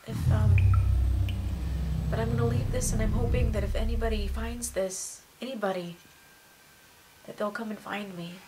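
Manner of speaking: very soft, low-pitched confession; subdued farewell, barely voiced; hushed last message. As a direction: leave your last words quietly. Recording a possible final message.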